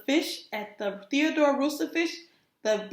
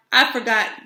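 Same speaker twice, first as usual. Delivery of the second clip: louder sheepish admission; 2 semitones lower, 7 dB louder than usual. Acted. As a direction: blurt it out. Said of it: "said Clark".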